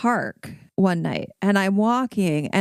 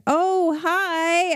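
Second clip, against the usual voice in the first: lightly voiced cheery Joanna, higher; a hint of a bright friendly hello; Joanna greeting; 8 semitones higher than usual. Retelling Joanna spotting her at the park, mock cheery.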